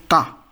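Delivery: German letter K said "ka"; pronuncia tedesca. Shown as a demonstration. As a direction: say "ka" with an open ah. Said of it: "K is pronunciation as".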